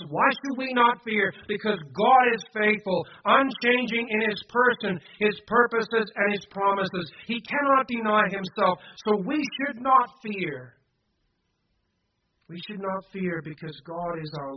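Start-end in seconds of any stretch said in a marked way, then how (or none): 0.1-10.7 loud, high, emphatic refrain; fervent preaching. Real, not acted. Preacher tells congregation God is faithful, fervent and loud. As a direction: hammer each point out with conviction. Refrain repeated at full force.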